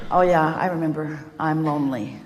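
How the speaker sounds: low, hammed-up glum self-talk; comic resigned gloom; mock forlorn. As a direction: sink into pretend loneliness, pitch dropping. Voicing lonely morning thoughts.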